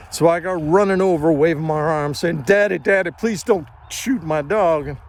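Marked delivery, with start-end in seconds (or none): 2.4-4.9 higher and louder, pleading; desperate plea. Retold or imitated. Boy begging his father to spare his dog, desperate. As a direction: beg him, voice straining.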